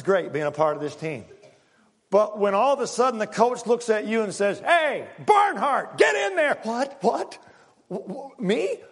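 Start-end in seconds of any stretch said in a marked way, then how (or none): 4.6-6.6 shouting the player's name, drawn out and high; a coach barking a player off the bench; coach calling out. Retold or imitated. 6.6-8.8 high, stammering surprise; a benchwarmer who can't believe it; stunned disbelief. Retold or imitated.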